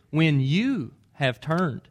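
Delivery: "When you have turned" slow and certain, weight on When; a calm certain promise, very slowly; firm. Retold or imitated. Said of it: "Jesus looks at Peter and says".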